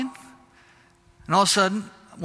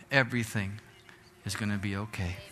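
much softer and lower, tender promise; gentle reassurance; 8 semitones lower, 9 dB quieter, faster than usual. Acted. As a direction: lay it softly on the listeners. Preacher reassures congregation.

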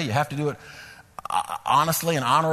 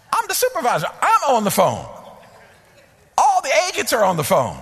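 high, loud, indignant retelling; exasperated outcry; 7 semitones higher, 3 dB louder than usual. Retold or imitated. Supervisor swamped with calls on hold, exasperated outcry. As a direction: belt it out in disbelief. Preacher relives an overloaded call center.

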